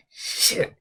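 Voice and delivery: breathy hushed swear; whispered curse. Retold or imitated. Caught by the roommate at the door, a whispered swear. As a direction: mutter it so nobody hears.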